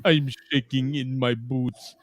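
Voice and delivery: put-on hammy, unhurried Russian accent. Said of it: "I give Neary a Russian accent".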